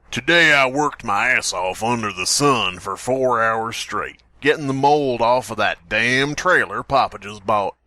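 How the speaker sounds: lightly Southern, a bit slow toward the end; Southern accent. Acted. Reading the Mississippi journal entry in a light Southern accent. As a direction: read it like a tired Mississippi kid. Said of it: "there goes the accent".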